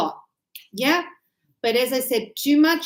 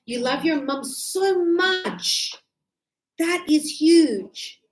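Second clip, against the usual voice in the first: slow emphasis; 3 semitones higher, much slower than usual. Shown as a demonstration. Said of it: "teaching emphasis".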